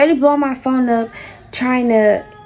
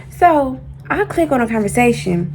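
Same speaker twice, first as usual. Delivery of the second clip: usual speaking voice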